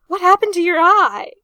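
loud, high, alarmed question; alarmed cry. A narrator's reading. Arthur alarmed at his friend's eye patch, loud cry. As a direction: cry out in shock. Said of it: "Arthur cried".

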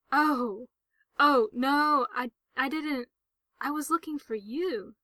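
loud, rushing, stumbling apology; startled, flustered outburst; flustered alarm. A narrator's reading. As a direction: blurt it out, suddenly alarmed. Frank realises he scared Gerard.

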